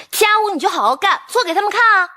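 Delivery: high, rallying cheer; rousing encouragement. Acted. Urging someone to prove themselves.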